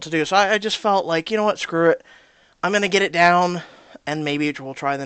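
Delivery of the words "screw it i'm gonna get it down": ordinary delivery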